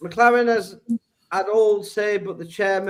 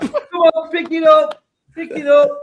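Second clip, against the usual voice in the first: much higher, louder coach bark; barking gym coach; 10 semitones higher, 7 dB louder than usual. Retold or imitated. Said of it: "That's Bushy shouting".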